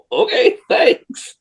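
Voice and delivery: high, slow, sheepish thanks. Retold or imitated. Racer handed free parts by strangers, high slow sheepish thanks. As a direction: stretch it out high, awkwardly grateful. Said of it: "And I'm like".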